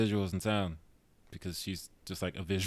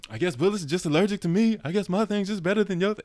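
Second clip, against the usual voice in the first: mock-aggrieved, higher and louder; upset venting; 10 semitones higher, 5 dB louder than usual. Co-host imitating the character venting mid-chaos, upset. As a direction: quote a petulant rant, pitch raised. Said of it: "he's just so upset and".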